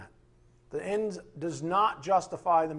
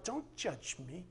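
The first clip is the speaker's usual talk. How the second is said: sneering mock; 12 dB quieter, slower than usual. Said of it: "don't ever listen to that garbage".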